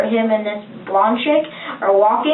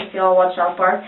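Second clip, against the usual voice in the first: ordinary talk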